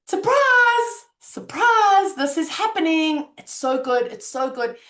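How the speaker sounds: high, loud cheerful "Surprise! Surprise!"; excited surprise. Shown as a demonstration. Greeting bad news as a happy surprise, loudly excited. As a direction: announce it like an unexpected party. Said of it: "take a moment to go".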